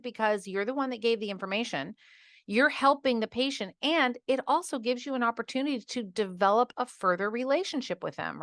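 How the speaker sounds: normal talking voice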